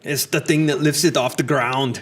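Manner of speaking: put-on thick Austrian action-hero accent; Austrian-accented action star impression; Arnold. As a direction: play an Austrian movie tough guy. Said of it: "If you cannot handle Arnold".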